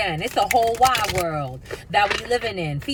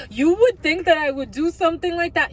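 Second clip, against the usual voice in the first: mock accuser; 10 semitones higher, 6 dB louder than usual. Mocking the accuser's claim.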